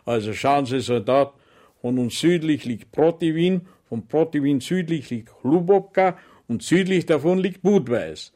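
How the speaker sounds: walk him through the map; sergeant explaining. Acted.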